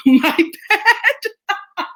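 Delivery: high-pitched laugh through the words; sheepish, laughing admission; laughing apology. Real, not acted. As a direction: break up laughing as you own up. Laughing apology after fetishizing an accent.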